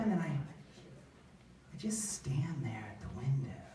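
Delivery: unvoiced slow whisper; creepy hushed whisper. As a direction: breathe it out like a ghost. Creepy standing still.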